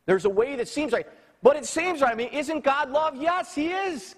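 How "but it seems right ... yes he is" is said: full-force preaching. Real, not acted. Preacher insisting God is love, at full force. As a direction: drive it home to the congregation.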